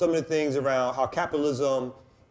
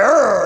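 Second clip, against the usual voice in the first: growl; 7 semitones higher, 13 dB louder than usual. Retold or imitated. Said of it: "I was like".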